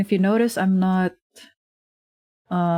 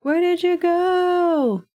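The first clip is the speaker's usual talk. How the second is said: put-on child voice; 11 semitones higher, slower than usual. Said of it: "be like".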